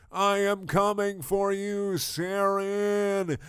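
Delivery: high, slow drawn-out cry; shout to the sky. Acted. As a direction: call out to the heavens. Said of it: "Garfield shouted to the sky".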